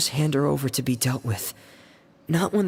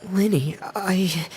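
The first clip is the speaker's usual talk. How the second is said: faltering whisper; 4 semitones higher, slower than usual. Acted. Faltering reply to his brother.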